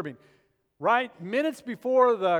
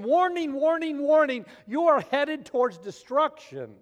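raised, louder acted alarm call; urgent warning; 6 semitones higher, 5 dB louder than usual. Preacher voices conscience warning of destruction, urgent warning. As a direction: sound the alarm, pitched up and forceful. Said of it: "it's the very thing saying".

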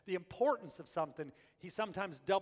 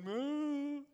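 mock cow moo; 10 semitones higher, 3 dB louder than usual. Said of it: "they'd be going".

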